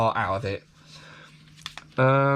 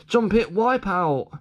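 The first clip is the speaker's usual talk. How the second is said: excited mock crash; 6 semitones higher, slower than usual. Acting out a crash.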